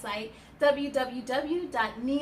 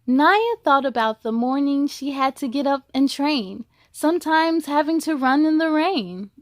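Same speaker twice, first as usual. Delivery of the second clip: rhyming storybook read with a steady lilt; picture-book narration; reading to children; 2 semitones higher, 8 dB louder than usual. A narrator's reading. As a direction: read it to a class of kids. Reads a picture book aloud.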